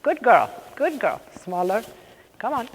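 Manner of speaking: ordinary delivery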